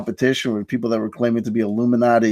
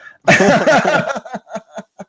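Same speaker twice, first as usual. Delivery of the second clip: a sudden high cackle that breaks out after the punchline; high burst of laughter; 12 semitones higher, 4 dB louder than usual. Real, not acted. Host cracking up at his own joke to the guest, high laugh.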